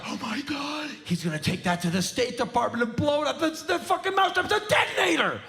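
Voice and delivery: high, hysterical neighbor shriek; mock panic. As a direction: freak out over a mousetrap.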